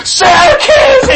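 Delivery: high, loud joyful cry; excited shout. Retold or imitated. Boy wakes his dad, shouting high with joy. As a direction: yell it like a thrilled kid.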